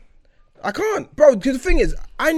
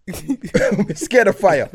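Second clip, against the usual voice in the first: very slow, stretched out through laughter; helpless giggling admission; laughing confession; 3 semitones higher, 4 dB louder, much slower than usual. Real, not acted. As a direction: admit it while cracking up. Admitting fear while laughing.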